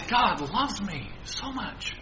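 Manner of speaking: hushed, slow imagined thought; a quiet wondering realisation; soft inner voice. Pretend. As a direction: say it softly to yourself.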